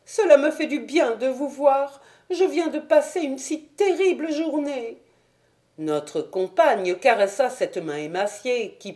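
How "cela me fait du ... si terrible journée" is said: put-on high, thin voice of an anxious old woman; an old servant unburdening her fear to a kind young woman; distressed old housekeeper. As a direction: sound worn out by a day of dread.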